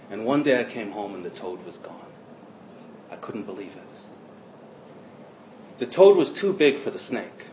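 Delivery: ordinary delivery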